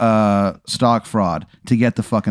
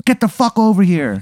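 high, demanding call; mock yell; 8 semitones higher than usual. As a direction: order them over, pitched up. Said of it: "I make a lot of noise".

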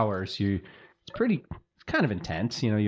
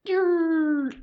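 sudden high yelp; high-pitched call; 3 dB louder than usual. Real, not acted. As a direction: squeak the name high. GM calls name in high voice.

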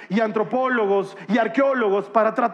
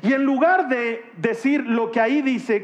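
normal talking voice

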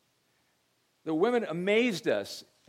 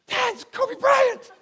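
high, loud, drawn-out shocked cry; stunned squeal; 10 dB louder, slower than usual. Retold or imitated. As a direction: shriek it like the teen who just saw a star. Daughter's stunned realization.

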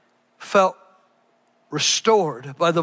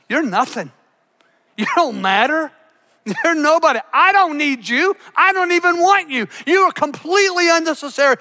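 higher, louder, contemptuous dismissal; mock scorn; 6 semitones higher, 3 dB louder than usual. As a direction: throw the younger son away with every line. Eve scorning her second son.

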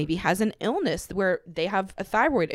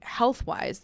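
normal talking voice

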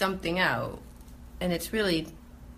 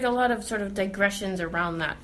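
usual speaking voice

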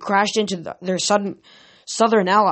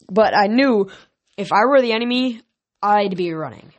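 ordinary delivery

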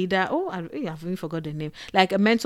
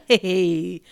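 ordinary talk

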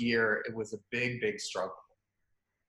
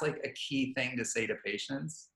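ordinary talk

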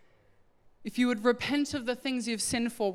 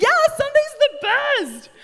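much higher, louder, beaming mock enthusiasm; mock cheery reply; 10 dB louder than usual. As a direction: chirp it back like an eager churchgoer. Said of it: "They're like".

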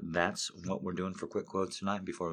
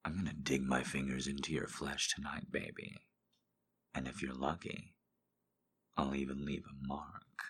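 much softer, low threatening purr; menacing hush; 6 semitones lower, 14 dB quieter than usual. Acted. Dominant lover murmuring a threat to his baby. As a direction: promise it darkly, hushed. Reads smutty quotes aloud.

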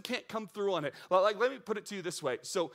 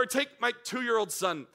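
usual speaking voice

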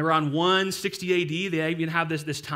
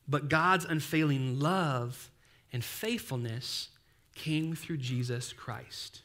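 scripture reading; 3 dB quieter, slower than usual. Acted. Reading scripture verse slowly.

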